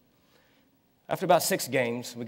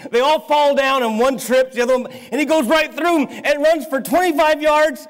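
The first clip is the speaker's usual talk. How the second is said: higher and louder, shouting the climax; excited shout; 9 semitones higher, 6 dB louder than usual. Real, not acted. Preacher tells the congregation of his son's touchdown, excited shout. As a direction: yell it like a touchdown call.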